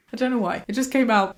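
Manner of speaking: ordinary delivery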